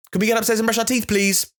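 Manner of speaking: put-on high, clear request to a child; chirpy high instruction to a child; clear kid talk. Shown as a demonstration. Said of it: "clear communication in short sentences".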